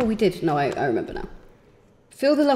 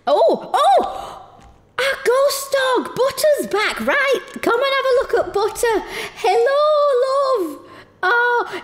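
cutesy pet voice; 13 semitones higher, slower than usual. Real, not acted. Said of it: "I stopped talking like a".

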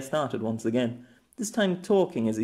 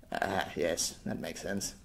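much softer, lower dry remark; dry deflated aside; 7 semitones lower, 8 dB quieter, slower than usual. Acted. Old man drily deflating at her answer, low and soft. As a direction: mutter it drily to himself.

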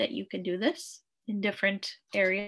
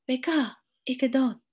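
"Beka, ike don" raised in pitch; emphatic, higher "Beka, ike don"; exclamation; 4 semitones higher than usual. Shown as a demonstration. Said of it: "this has an exclamation mark as well".

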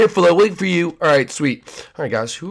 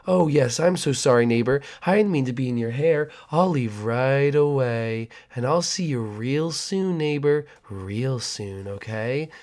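sinister neighbor; 4 dB quieter than usual. Acted. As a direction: linger on real soon like a threat. Creepy neighbor leaves ominously.

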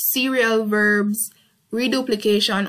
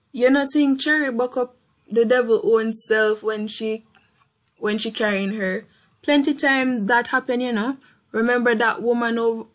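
Creole dialogue reading. Acted. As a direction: read it as a chatty Creole neighbour. Reads Creole gossip passage aloud.

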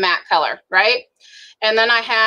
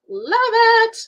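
squealed "Love it" jumping high; delighted high-pitched "Love it"; gushing; 14 semitones higher than usual. Real, not acted. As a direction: gush about the palette with a high squeal. Gushing over the palette.

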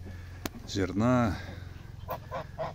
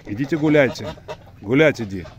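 loud, high call shooing the birds; shooing birds away; 4 semitones higher, 9 dB louder than usual. Real, not acted. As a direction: shout them off, high and loud.

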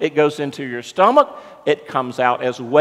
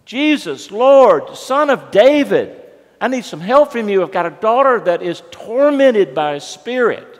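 raised, higher-pitched desperate cry for help; acted-out shouted plea, short of a scream; imitated screaming plea; 6 semitones higher, 5 dB louder than usual. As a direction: call out loudly like a mother begging for help. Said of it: "starts screaming at Jesus".

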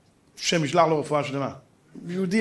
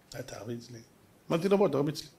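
low and very soft, unruffled; calm challenge; 3 semitones lower, 8 dB quieter than usual. Retold or imitated. Speaker answers the man threatening to hit him, quietly calm. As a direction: answer the threat under your voice. Own calm reply to the shouter.

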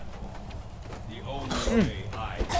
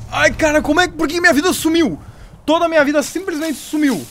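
shocked disbelief; 7 semitones higher than usual. Real, not acted. Health bar suddenly gone.

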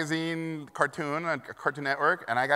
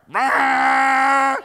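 mock leaf blower roar; 12 semitones higher, 13 dB louder than usual. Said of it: "they were like".

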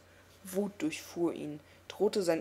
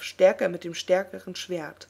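ordinary delivery

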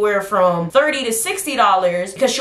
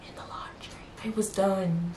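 whisper; 13 dB quieter, slower than usual. Acted. Whispered reveal of dead rat.

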